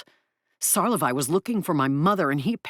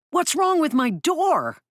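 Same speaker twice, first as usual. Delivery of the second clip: alarmed; 14 semitones higher, 5 dB louder, slower than usual. Acted. Alarmed question.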